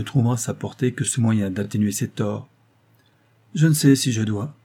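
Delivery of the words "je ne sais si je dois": plain reading voice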